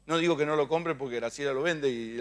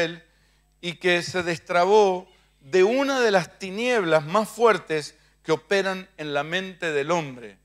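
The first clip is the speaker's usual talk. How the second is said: slower and firmer, leaning on Dios; emphatic repeat. Shown as a demonstration. Preacher repeats his point to the church, slow and emphatic. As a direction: hammer it home word by word. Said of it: "Se lo digo otra vez".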